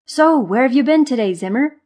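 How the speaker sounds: high, loud, friendly question; eager curiosity. A narrator's reading. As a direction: ask your friend with bouncy interest. Curious Marva changes the subject.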